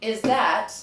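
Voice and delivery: ordinary talk, nothing marked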